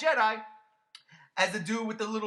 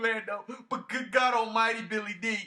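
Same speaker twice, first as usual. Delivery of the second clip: usual speaking voice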